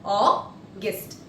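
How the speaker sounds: AUGUST split as Au, pause, gust; syllable-by-syllable demo. Shown as a demonstration. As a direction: break "August" into two separate syllables. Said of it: "this is how we pronounce August".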